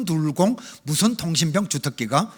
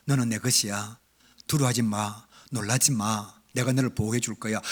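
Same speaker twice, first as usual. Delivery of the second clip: voice of God; 8 semitones lower, 6 dB quieter than usual. Retold or imitated. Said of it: "이상한 음성이 들리는 거예요".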